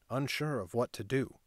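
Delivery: usual speaking voice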